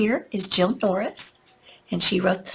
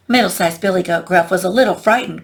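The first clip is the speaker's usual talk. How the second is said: ordinary delivery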